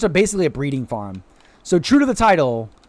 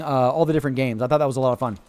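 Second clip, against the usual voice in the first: ordinary delivery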